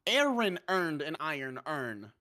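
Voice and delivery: slow, deliberate spelling-out; slow careful reading. Retold or imitated. Friend retelling a video, slow careful reading. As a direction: read each word slowly. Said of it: "he's like".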